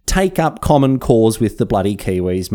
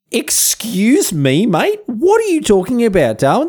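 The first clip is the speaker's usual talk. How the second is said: much higher, slow, incredulous "excuse me, mate?"; mock indignation; 12 semitones higher, slower than usual. Host scolding Darwin's diary entry, mock indignant and high. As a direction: play up disbelief like an offended Aussie.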